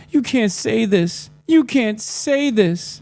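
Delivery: high, slow whiny mock voice; mocking fans. Mocking angry fans, high whiny voice. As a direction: whine it like an outraged fan. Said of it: "They're like".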